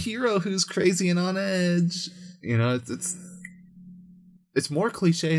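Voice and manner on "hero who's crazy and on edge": mocking trope voice. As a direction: parody the trope in a high mocking voice. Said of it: "this archetype where it's like".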